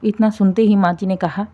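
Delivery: ordinary delivery, nothing marked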